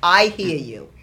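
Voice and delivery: loud, falling acted reply to God; emphatic retold acknowledgement. Speaker recounts answering God after her fall, loud and emphatic.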